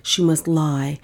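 ordinary delivery

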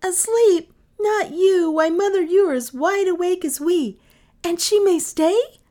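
high, loud, giddy teasing; gleeful children. A narrator's reading. Children teasing their mother she is awake, gleeful. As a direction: kids laughing at a silly question. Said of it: "how the children laughed".

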